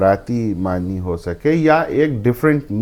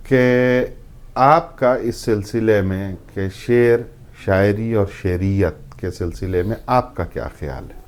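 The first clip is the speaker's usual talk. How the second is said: ordinary talk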